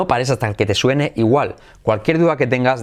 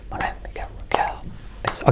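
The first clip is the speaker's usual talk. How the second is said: voiceless whisper, leaving room to repeat; whispered prompt; 9 dB quieter than usual. Shown as a demonstration. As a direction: whisper it along under the learner's turn. Whispers during the learner's turn to repeat. (susurrando para que repitan)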